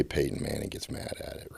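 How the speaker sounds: soft unvoiced whisper; whispered aside. Real, not acted. Whispering an aside to the audience about a rival.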